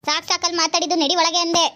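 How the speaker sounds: loud, sharp mother-in-law scolding; mother-in-law yelling at her son's wife for coming home late; angry scolding. Acted. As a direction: bark at the late daughter-in-law. Mother-in-law orders her inside.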